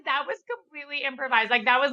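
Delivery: ordinary delivery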